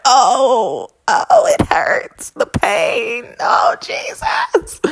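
loud, high, stretched-out fake sobbing; mock agonized wailing. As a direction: wail in hammed-up agony. Acts out own hurt reaction comically.

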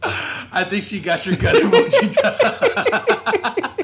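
laughing hard mid-sentence; words breaking up with laughter. Real, not acted. Laughing at the wife's reply.

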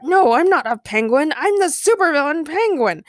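high, indignant storybook villain; annoyed shout. A narrator's reading. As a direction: protest loudly in a put-on villain voice. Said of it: "Penguin shouted back, annoyed".

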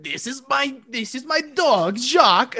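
lightly voiced French accent; mock French man. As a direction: proudly introduce the dog in fake French. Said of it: "he's like".

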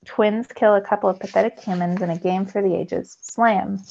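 plain reading voice

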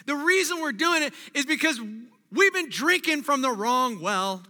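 preaching at full force. Acted. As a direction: drive the point home to the church.

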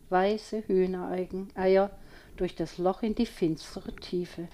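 ordinary delivery, nothing marked

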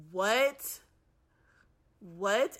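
outraged what. Real, not acted. Reacting to ten dollar price.

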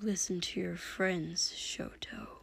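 hushed, grating near-whisper; very soft, low and lucid; said quietly. A narrator's reading. Dabi advising his brother Shoto, quietly. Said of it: "Dabi said quietly".

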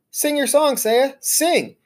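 high, squeaky call as loud as possible; squeaked out loudly. Acted. Hamster squeaking loudly to urge Saya to sing. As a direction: cheer her on in a high squeak. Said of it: "I squeaked out as loudly as I could".

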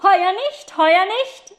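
high, loud call; boy calling down to the girl, high and loud. Acted. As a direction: shout it down to her. Boy calls down from above.

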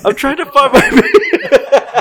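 high slow laughing mock of a drunk; mocking drunk friend. Mocking the drunk friend's excuse, high slow laughing. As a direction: mimic your drunk buddy, cracking up. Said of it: "Oh, yeah, that's right".

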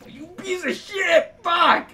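slow, high, loud cursing; drawn-out exasperated yell; frustrated shout. Real, not acted. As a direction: curse out a useless teammate. Cursing at a failed game throw.

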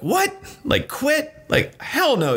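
sky-high disbelieving "What?", then settling; a coach who can't believe what he's hearing; incredulous coach. Retold or imitated. Coach reacting to client quitting.

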